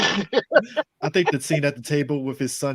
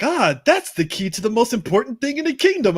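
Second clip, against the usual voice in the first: higher-pitched mock discovery; mock revelation; 8 semitones higher, 4 dB louder than usual. Retelling a movie character's discovery, mock revelation. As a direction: announce the big find in an imitating voice. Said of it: "He's like".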